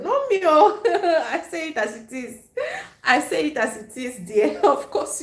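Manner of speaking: giggling, high and loud; bursting into giggles; laughing. Real, not acted. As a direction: laugh through the words. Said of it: "I'm laughing so much".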